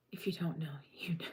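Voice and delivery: a soft secretive whisper; whispered aside. Real, not acted. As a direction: lean in and whisper it. Conspiratorial aside.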